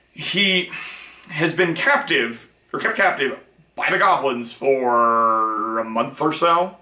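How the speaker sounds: ordinary talk, nothing marked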